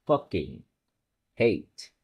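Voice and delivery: ordinary delivery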